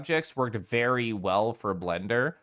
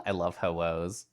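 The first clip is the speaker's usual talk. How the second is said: usual speaking voice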